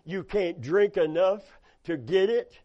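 ordinary talk

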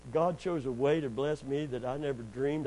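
usual speaking voice

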